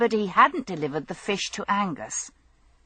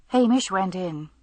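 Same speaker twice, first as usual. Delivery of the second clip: plain reading voice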